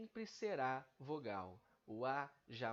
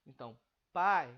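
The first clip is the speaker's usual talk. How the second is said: pai punched loud, the A strong and the I faint; stress on pai; 15 dB louder than usual. Shown as a demonstration. Teacher showing the falling diphthong in pai, loud on the A. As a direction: hit pai hard, let the I fall away. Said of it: "Você decresce a força da emissão".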